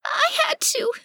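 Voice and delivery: very high, squeaky creature voice near tears; tearful duck lady. Acted. Cecily explaining herself to the party in tears, very high and squeaky. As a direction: squeak it out high, choked with longing.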